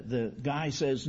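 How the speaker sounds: ordinary talk, nothing marked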